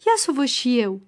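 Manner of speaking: plain reading voice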